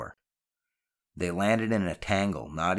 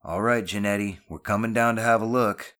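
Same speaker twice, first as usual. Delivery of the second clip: louder, raised-pitch announcement; calling down; 4 semitones higher, 5 dB louder than usual. Acted. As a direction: call down that you're on the way. Boy calls down to friend.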